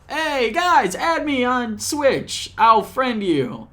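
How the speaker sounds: sweet overdone welcome; mock cheerful. Mock cheerful redo of Switch pitch.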